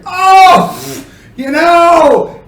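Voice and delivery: high, loud laughing "Oh, you know"; laughing exclamation. Shown as a demonstration. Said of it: "you're laughing".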